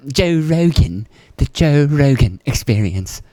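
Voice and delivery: slow, drawn-out announcer read of the show name; playful slow show-name announcement; mock announcer. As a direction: stretch it out like a hype intro.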